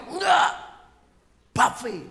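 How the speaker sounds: high, loud refusal; objecting husband. Acted. Husband refuses wife's food choice, loud objection. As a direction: blurt out the counter-demand high and loud.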